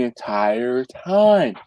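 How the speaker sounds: slowly stretched final words; slow drawled ending. Retold or imitated. Finishing the drifter imitation, slow. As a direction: drag the phrase out. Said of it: "he talks just like this".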